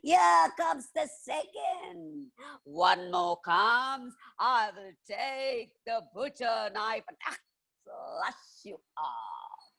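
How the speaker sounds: high, slow threat that drops to a soft hiss at the end; menacing witch. Acted. Witch threatens the robber, slow and menacing. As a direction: savour each word of the threat. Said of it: "she said".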